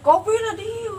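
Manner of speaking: high wailing possessed voice. Pretend. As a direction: wail like a possessed spirit. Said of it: "Aing pura-pura kesurupan gitu". (meraung seperti orang kesurupan)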